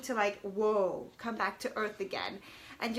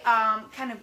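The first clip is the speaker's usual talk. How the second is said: normal talking voice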